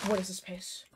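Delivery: whispering. Real, not acted. Stunned at own pace.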